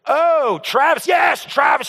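high-pitched mock exclamation. Associate recognizes who the customer means, high exclaiming.

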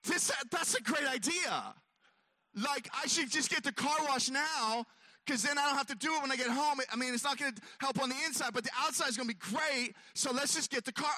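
eager, high and quick self-talk; excited to himself. Retold or imitated. Talking himself into a car wash, excited. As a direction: talk yourself into a great idea. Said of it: "And I'm like".

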